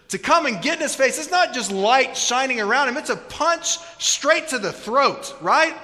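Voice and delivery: impassioned preaching. Real, not acted. Preacher building to climax.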